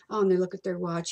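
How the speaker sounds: usual speaking voice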